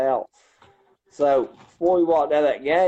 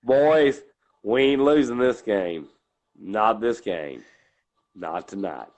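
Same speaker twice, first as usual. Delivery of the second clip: deep low coach voice; 5 semitones lower, slower than usual. Shown as a demonstration. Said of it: "You go into that deep low".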